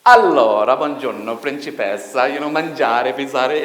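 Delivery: loud, exaggerated Italian accent, big and expressive; Italian impression. Talking about Italians, a loud exaggerated Italian accent. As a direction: ham up a gesturing Italian, loud. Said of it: "I can think of Italian people".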